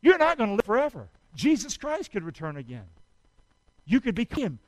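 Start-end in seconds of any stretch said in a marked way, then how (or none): none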